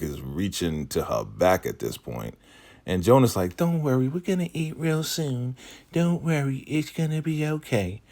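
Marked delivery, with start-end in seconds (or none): 3.6-8.0 high, put-on soothing voice; reassuring woman. Jonah telling starving newcomer food is coming, high and reassuring. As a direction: imitate her cooing reassurance, pitched way up.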